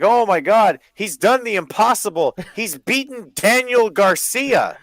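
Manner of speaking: high, loud overblown celebration; hammed-up awe, stretching the name; mock amazement. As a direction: hail a tiny win like a miracle. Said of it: "It's like, it's like".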